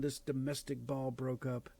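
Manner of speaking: usual speaking voice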